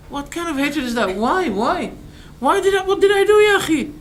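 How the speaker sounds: hammed-up why did I do; mock shocked protest. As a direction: play the wronged innocent, pitching up. Acting the shocked victim.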